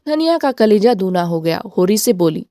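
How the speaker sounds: ordinary delivery, nothing marked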